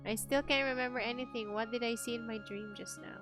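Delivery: ordinary delivery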